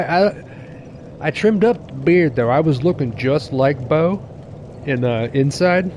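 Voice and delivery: normal talking voice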